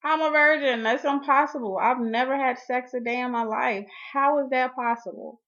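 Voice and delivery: shocked virgin protesting to the doctor, loud and indignant. Retold or imitated. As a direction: fire back at the doctor, voice up and loud. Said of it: "And I'm like".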